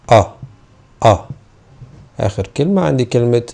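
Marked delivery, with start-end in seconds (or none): 0.1-1.2 the vowel أ short and neutral; short U sound. Shown as a demonstration. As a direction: clip the vowel أ like cup. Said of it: "short يو هتنطق أ".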